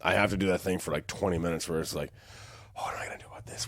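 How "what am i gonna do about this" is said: hushed, half-asleep fretting to himself; whispered self-talk. Retold or imitated. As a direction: whisper it like a dream-addled worrier. Acting out waking from weird dream.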